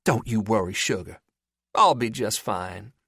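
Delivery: a hint of the teasing Southern belle; Southern accent. A narrator's reading. Lowell still teasing Bolton, light mock Southern accent. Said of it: "in a falsetto Southern accent".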